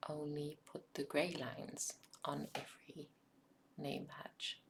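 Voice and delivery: ordinary delivery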